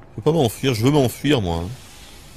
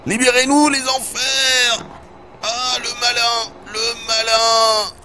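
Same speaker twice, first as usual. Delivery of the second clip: high, slow hammed-up wail; mock exorcist; 12 semitones higher, slower than usual. Streamer mocking the scene with a high slow exorcism wail. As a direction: wail it like a possessed preacher.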